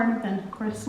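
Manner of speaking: normal talking voice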